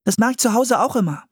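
high, quick, eager chatter; enthusiastic. A narrator's reading. Anne tells how she helps at home, enthusiastic. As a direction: rattle it off keenly, pitched high. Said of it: "sagte Anne begeistert".

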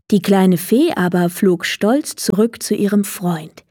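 ordinary delivery, nothing marked

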